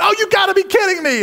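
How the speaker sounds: high-pitched exasperated shout; fan's outraged high yell. Retold or imitated. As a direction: shout it at the game like a fed-up fan. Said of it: "I yell at the T V".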